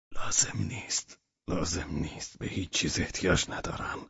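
hoarse near-whisper, pushing him off; breathless sick man waving help away; weak refusal. Acted. The sick host refuses the fussing guest in a faint whisper.

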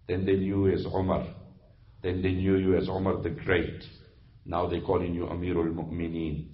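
low, hushed, solemn warning; soft, low-pitched voice of stern counsel; grave woman admonisher. Acted. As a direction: admonish a ruler quietly and gravely. Plays woman admonishing Umar.

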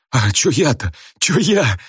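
high, wobbling simpleton's voice; bashful mumbling. A narrator's reading. Shy puny boy fallen into the aisle, bashfully mumbling. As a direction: mumble it in sheepish bewilderment. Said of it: "застенчиво улыбался и бормотал".

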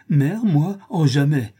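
high, loud, horrified refusal; anguished outcry. A narrator's reading. Daughter waking from her enchanting dream, anguished cry. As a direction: cry out in pained disbelief. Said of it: "s'écria Fleur de Marie avec un cri déchirant".

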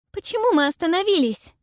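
high, loud and alarmed; alarmed girl. A narrator's reading. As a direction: ask it in sudden alarm. Pippa alarmed in boat.

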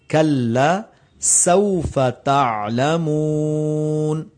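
کلا سوف تعلمون recited slowly with a clear k and a whistling س; slow recitation. Shown as a demonstration. Teacher reciting the verse correctly for students. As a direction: stretch it out melodically. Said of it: "سین پر سیٹی کی آواز نہیں آتی".

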